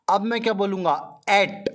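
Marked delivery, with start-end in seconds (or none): 1.3-1.7 At with a wide open a; open a sound. Shown as a demonstration. Teacher contrasting At against It for the class. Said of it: "इसको कैसा बोला मैंने".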